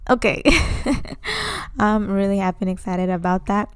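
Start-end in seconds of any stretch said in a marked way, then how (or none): 0.5-1.7 short laugh. Real, not acted. Laughs at own slip.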